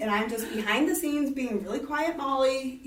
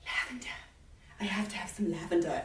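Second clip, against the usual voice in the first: breathless whisper; 5 semitones lower, 9 dB quieter than usual. Retold or imitated. Lady gasps over the purse.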